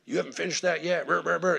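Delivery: mild mock yell trailing into gibberish; mock boss shouting. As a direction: imitate a boss getting on someone's back. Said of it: "your boss is just over there shouting at you".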